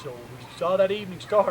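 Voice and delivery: ordinary delivery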